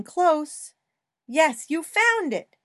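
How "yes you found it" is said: put-on high, bright cheer for a toddler; excited praise. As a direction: celebrate the find, higher and louder. Child finds hidden toy.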